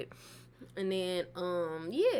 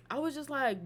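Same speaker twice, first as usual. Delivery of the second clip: usual speaking voice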